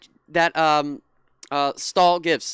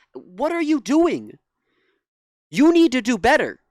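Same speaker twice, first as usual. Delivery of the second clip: frustrated scolding; 4 semitones higher, 5 dB louder than usual. Real, not acted. Scolding the network directly.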